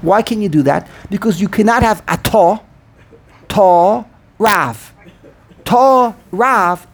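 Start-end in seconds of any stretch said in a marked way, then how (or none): 2.1-6.9 separated beats, "Ta" and "Rav" each stressed alone; two primary accents. Shown as a demonstration. Teacher showing no buffer, isolated stressed syllables. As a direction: give "Ta" and "Rav" equal separate punches. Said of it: "each one is a primary accent".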